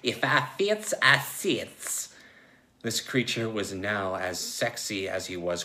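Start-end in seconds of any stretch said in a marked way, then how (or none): 0.0-2.1 mild purr, higher pitched; lightly voiced purring big cat; purring tiger. Furry Joe purrs as he squeezes through the glass, playful purr. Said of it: "and purred".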